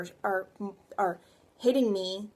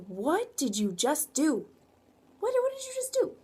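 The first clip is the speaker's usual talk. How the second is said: sharp accusing demand, much higher on the repeat; acting angry; 5 semitones higher than usual. Shown as a demonstration. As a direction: snap at someone who just wrecked your plan. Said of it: "I was angry".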